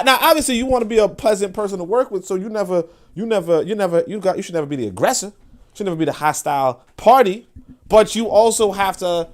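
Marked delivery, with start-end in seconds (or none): none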